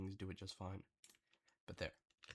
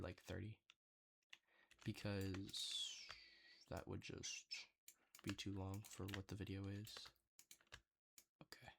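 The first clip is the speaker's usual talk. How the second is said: ordinary talk, nothing marked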